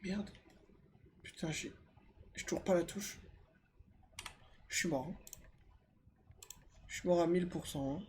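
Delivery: hushed whisper while hiding. Real, not acted. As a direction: keep it low so it won't hear. Hiding from monster nearby.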